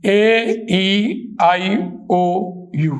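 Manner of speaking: drawn-out اے، ای، آئی، او، یو, slow and clear; vowel sounds. Shown as a demonstration. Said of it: "teacher demonstrates vowel sounds". (حروف علت کی آوازیں آہستہ نکالنا)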